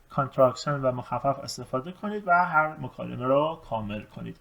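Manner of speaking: ordinary talk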